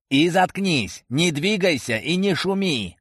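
high, urgent narrated yell, volume held back; yelled orders. A narrator's reading. Archer yells at partner to keep still, urgent shout. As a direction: pitch it up as a shouted command. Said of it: "как можно громче орал я".

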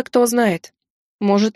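usual speaking voice

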